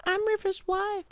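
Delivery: high mock moaning woman; falsetto woman voice. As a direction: squeal it out as a fake wife. Falsetto wife role-play.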